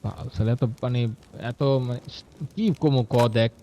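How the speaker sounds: frustrated mutter. Real, not acted. Frustrated, lost for words.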